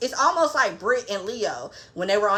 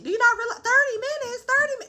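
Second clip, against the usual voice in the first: incredulous; 12 semitones higher, faster than usual. Real, not acted. Incredulous rant at Monica.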